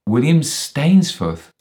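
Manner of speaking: high, loud, slow exclamation; astonished echo. Acted. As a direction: repeat the name in startled disbelief. The name echoed in astonishment.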